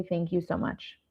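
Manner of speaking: soft, low-key mock thanks; polite brush-off. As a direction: say it quietly, just to placate. Models polite answer to unwanted advice.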